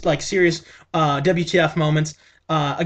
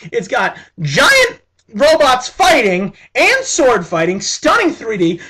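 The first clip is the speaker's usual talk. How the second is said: excited; 6 semitones higher, 6 dB louder, slower than usual. Real, not acted. Excited pitch to viewers.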